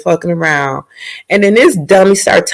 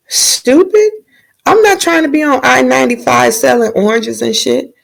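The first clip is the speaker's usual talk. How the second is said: high scoffing put-down; mock scornful princess; 8 semitones higher than usual. Princess brushing off the knight's proposal, high mock scorn. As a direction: laugh off his silly proposal.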